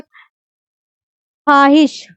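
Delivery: ख्वाहिश slowly as "khaahish", no "w"; slow "khaa-hish", vav unsaid; silent vav. Shown as a demonstration. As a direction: say ख्वाहिश going from "kh" straight to "aa". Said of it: "जिसमें वाव की तलफ़ुज़ नहीं".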